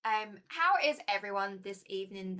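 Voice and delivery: ordinary delivery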